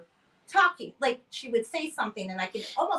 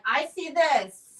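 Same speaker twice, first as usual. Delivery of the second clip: higher, slow, drawn-out mock psychic pronouncement; mock dramatic psychic; 5 semitones higher, 6 dB louder, much slower than usual. Mocking psychics who visit her place, slow and dramatic. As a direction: overplay a theatrical psychic. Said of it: "it's a little dramatic sometimes".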